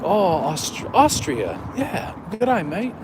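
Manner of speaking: imitating a film's mock Australian greeting; hammed-up Australian movie line; movie quote impression. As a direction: climb high into a silly Aussie accent. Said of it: "It's from Dumb and Dumber".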